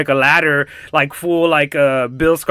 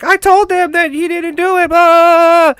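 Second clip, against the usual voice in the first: very high mock-whiny kid voice; wailing girl; 14 semitones higher, 4 dB louder than usual. Girl protesting to her friend, high exaggerated mock wail. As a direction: whine it in falsetto, ending in a loud wail.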